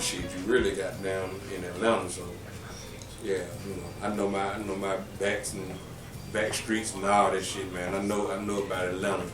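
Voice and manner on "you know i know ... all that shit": usual speaking voice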